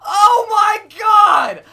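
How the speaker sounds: slow, high, laughing-crying scream; rage scream. Real, not acted. Gamer screaming after a grenade kill, slow high rage. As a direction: howl in outrage at the bullshit.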